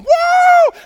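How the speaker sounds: very loud, high "woo"; soaring imitated angel cry; angel's whoop. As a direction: whoop at full volume, way up high. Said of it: "And the angel's going".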